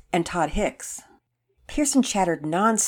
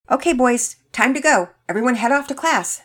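higher, louder call to disperse; brisk adult order; 7 semitones higher, 7 dB louder than usual. A narrator's reading. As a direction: send the boys off to class with authority. Worker sends boys to class.

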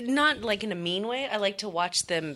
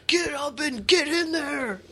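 yelling trainer; 8 semitones higher than usual. Retold or imitated. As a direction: bark it like a gym trainer. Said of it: "They're like".

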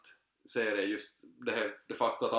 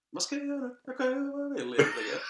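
high-pitched, giddy put-on inner voice; imitating own thoughts; 14 semitones higher, faster than usual. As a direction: voice your thoughts high, half laughing. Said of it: "började bara tänka sådär". (tillgjort ljus, fnissig tankeröst)

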